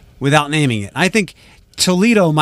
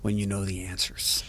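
whisper; 6 semitones lower, 13 dB quieter than usual. Real, not acted. Aside dropped to whisper.

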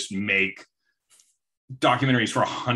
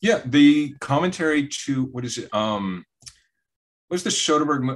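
ordinary talk, nothing marked

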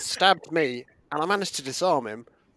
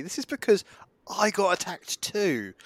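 ordinary delivery

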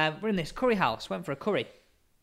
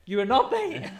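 deadpan quote; 9 semitones higher than usual. Retold or imitated. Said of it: "looking at his menu and just went".

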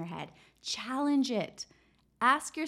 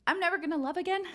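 higher, incredulous rising echo of the critic; mocking disbelief; 8 semitones higher, 4 dB louder, much faster than usual. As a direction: throw the line back as ridiculous. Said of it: "challenge it".

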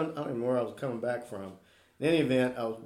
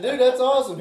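excited praise; 11 semitones higher, 9 dB louder than usual. Retold or imitated. Excited reply to son's grade.

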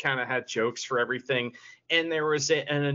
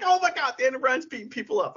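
much higher, fast fanboy gush; excited; 9 semitones higher, faster than usual. Retold or imitated. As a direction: gush like a fan marking out. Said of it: "and I'm like".

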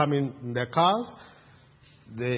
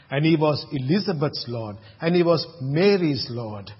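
ordinary talk, nothing marked

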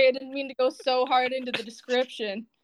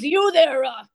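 barkeep calling out; 3 semitones higher, 4 dB louder than usual. Acted. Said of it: "he goes".

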